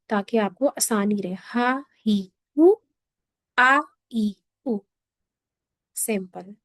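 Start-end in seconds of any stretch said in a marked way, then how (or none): 1.5-4.8 ہا، ہی، ہو then آ، ای، او, each vowel said separately; drilled letter sounds. Shown as a demonstration. Teacher drills the vowel sounds for students. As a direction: sound out ہا ہی ہو آ ای او clearly. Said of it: "آپ لوگوں نے اس کی practice کرنی ہے".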